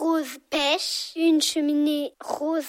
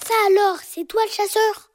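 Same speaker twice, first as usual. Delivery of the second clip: high, loud astonished cry; 5 semitones higher, 6 dB louder than usual. Acted. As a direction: exclaim in amazement. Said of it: "s'écrie ce dernier".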